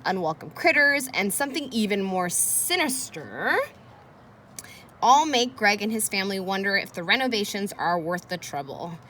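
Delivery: put-on expressive read-aloud for children; reading aloud to kids. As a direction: read it like a teacher hooking the class. Reading the book's back blurb.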